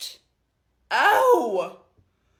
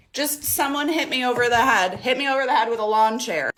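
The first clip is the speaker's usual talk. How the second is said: usual speaking voice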